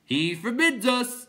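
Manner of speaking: high, slow, loud proclamation; announcing clown. A narrator's reading. Clown informing the others, high slow announcement. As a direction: stretch it out for the crowd.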